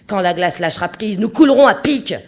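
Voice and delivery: hurlé, de plus en plus haut et fort; cri furieux. A narrator's reading. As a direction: crie la catastrophe à tous. Capitaine furieux crie à l'équipage. (le capitaine annonce le naufrage en criant)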